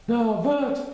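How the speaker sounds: high, loud bear admiring; proud Pooh. A narrator's reading. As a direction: admire himself in the mirror. Pooh's voice.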